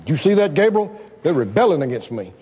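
lightly voiced God, confiding and dropping low; mock concern, sinking in pitch; mock-worried God. God telling Gabriel of the rebellion, lightly mock-worried. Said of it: "he that sits in the heavens goes".

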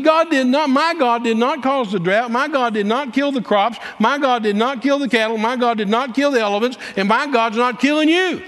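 high-pitched, hammered preaching rebuttal; fervent denial. Retold or imitated. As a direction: drive each denial home, re-enacting the argument. Retold argument, insistent denials.

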